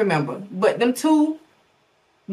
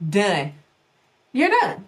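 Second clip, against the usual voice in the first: emphatic; slower than usual. Shown as a demonstration.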